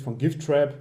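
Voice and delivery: normal talking voice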